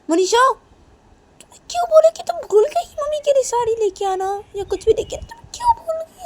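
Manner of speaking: loud, very high accusing outburst; angry confronting. Acted. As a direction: corner her, voice shrieking up. Sister angrily confronts Manisha.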